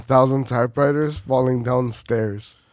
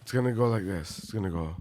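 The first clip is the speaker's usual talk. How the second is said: normal talking voice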